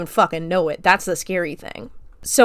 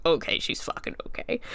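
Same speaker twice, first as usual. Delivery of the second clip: skeptical mutter; 8 dB quieter than usual. Retold or imitated. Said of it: "looking skeptical".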